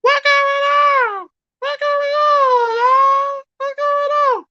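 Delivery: very high, slow, stretched squeaky voice; chopper voice. Pretend. Streamer asking chat about StreamYard, in a squeaky chopper voice. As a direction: drag it out in a high cartoon squeak. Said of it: "I'm gonna do it in my chopper voice".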